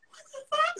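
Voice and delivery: high, stunned disbelief. Retold or imitated. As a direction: squeak it out, floored. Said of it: "I was like".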